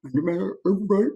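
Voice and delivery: lightly put-on misshapen-bite voice; jutting-jaw character voice. Shown as a demonstration. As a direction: demo of an overbite mouth. Demonstrates voices from mouth shapes.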